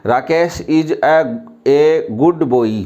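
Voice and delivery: "a" said as a long "ए", very slow and drawn out; teacher reads it the wrong way on purpose, "a" as "ए"; wrong pronunciation demo. Shown as a demonstration. A teacher showing students the common mistake, slow "a" as "ए". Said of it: "अगर मैं इसको गलत पढ़ूँ तो ऐसे पढ़ेंगे".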